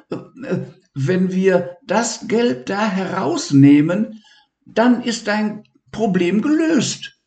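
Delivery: high, eager, tripping over words; high-pitched excited boy; excited discovery. Acted. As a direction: blurt out the find. Levi excited at finding book.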